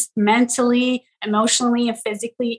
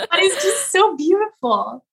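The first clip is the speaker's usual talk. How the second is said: laughing; 9 semitones higher than usual. Real, not acted. Laughing about her own bias.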